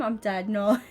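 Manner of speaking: ordinary talk, nothing marked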